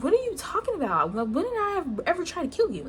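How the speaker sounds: high-pitched, indignant questioning; retold disbelief at an accusation; incredulous. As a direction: act out baffled protest, pitched well up. Retold reply to friend's accusation.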